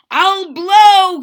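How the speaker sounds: high loud shout. A narrator's reading. Trumpeter vowing to sound the alarm, loud shout. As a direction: bellow the threat out. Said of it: "he shouted".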